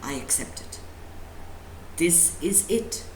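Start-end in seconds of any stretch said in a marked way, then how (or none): none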